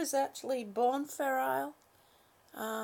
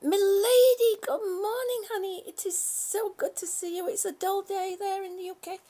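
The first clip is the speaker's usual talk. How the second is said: sing-song greeting; 6 semitones higher, 6 dB louder than usual. Real, not acted. Greeting viewer in high sing-song.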